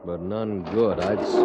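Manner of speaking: ordinary talk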